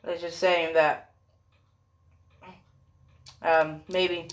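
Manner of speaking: usual speaking voice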